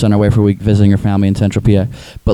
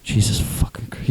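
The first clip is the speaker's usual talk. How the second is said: slow exasperated whisper; imitating a hushed, fed-up mutter; under the breath; 7 dB quieter, slower than usual. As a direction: curse to yourself, barely voiced. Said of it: "You heard me under my breath".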